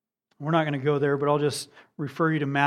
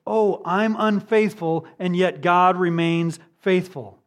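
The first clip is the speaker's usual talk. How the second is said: mock excuse; 3 semitones higher, 5 dB louder than usual. Preacher voicing someone excusing a life of sin, mock excuse. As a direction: put on a glib, loud self-justification. Voices a careless sinner's excuse.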